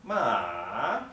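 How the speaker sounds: "Ma" in a long falling-then-rising tone; third tone. Shown as a demonstration. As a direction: start "Ma" high, sink down, then swing up. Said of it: "it begins high, goes down, and comes up".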